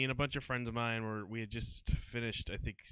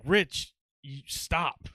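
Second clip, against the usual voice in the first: loud, higher-pitched call to a friend; urgent warning call; 5 semitones higher, 9 dB louder, much slower than usual. Retold or imitated. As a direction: call out sharply to stop a friend. Friends calling out to warn him.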